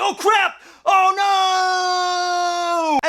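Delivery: high, loud comic wail; theatrical yell with a long-held "no"; panicked shout. Acted. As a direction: cry out in hammed-up horror. Heroes realise they are stuck.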